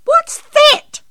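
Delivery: very high, loud shriek; startled yell. A narrator's reading. Auntie May startled by the crash, yelling. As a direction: shriek the question in alarm. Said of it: "yelled Auntie May".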